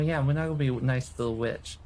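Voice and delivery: ordinary talk, nothing marked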